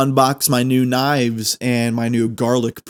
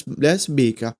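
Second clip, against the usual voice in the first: normal talking voice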